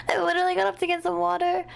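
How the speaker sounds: ordinary talk, nothing marked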